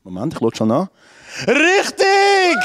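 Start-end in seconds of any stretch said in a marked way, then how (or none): 1.5-2.6 high, bright call of the answer; excited announcer. Acted. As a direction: proclaim it like a game show host. Host announces a correct answer.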